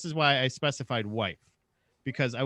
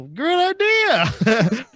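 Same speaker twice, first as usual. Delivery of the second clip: drawn-out, much higher and louder "good idea"; mock enthusiastic agreement; 10 semitones higher, 9 dB louder, much slower than usual. Agreeing to the audiobook idea in a slow, loud mock cheer. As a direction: stretch it out like an overeager yes. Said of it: "I go".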